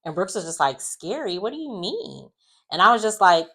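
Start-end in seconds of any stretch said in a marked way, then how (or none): 0.8-2.3 high, softer incredulous question; puzzled imitation. Brooks questioning the word, high and puzzled. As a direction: imitate puzzlement with pitch lifted.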